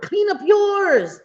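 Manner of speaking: high, slow and drawn out, mocking; sassy comeback. Telling the critics to clean up their own yard, high and slow and mocking. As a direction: stretch it high and slow, like a taunt. Firing back at online critics.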